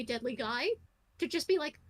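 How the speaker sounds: ordinary delivery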